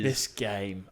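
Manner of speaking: deep, low mock announcer voice on this game; mocking partner's delivery. Mocking her partner's pet phrase in a deep mock voice. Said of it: "can you never say that again".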